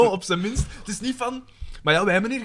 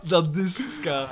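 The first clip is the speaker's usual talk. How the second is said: slow echo; much slower than usual. Real, not acted. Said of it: "echoing slowly".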